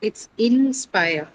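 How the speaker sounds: "inspire" drawn out slowly, stress on "spire"; slow stress demo. Shown as a demonstration. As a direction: stretch "inspire" and lean on "spire". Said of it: "where is the stress in inspire".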